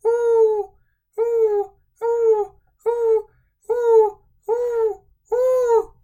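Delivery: high, loud hooting owl. Pretend. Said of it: "He can sound like an owl".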